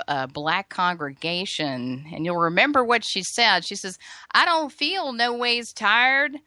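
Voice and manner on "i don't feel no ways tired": Southern twang, slow and a bit higher; imitating Hillary's put-on Southern drawl; mock Southern accent. Host quoting Hillary's Selma speech in a mock Southern accent. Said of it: "she had a heavy Southern twang".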